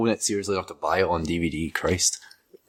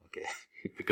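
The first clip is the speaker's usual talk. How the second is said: whisper; 12 dB quieter, much faster than usual. Real, not acted. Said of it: "whispered start".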